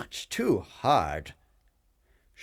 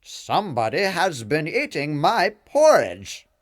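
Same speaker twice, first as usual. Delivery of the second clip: louder, gruff-toned papa bear; big papa bear, louder and indignant; 6 semitones higher, 9 dB louder than usual. A narrator's reading. Papa Bear finds his porridge eaten, loud bear voice. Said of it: "said in his Papa Bear voice".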